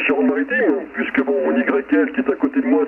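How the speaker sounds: ordinary talk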